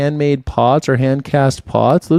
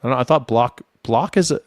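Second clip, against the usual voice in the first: ordinary delivery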